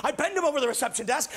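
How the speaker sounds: ordinary talk, nothing marked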